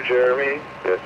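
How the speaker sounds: ordinary talk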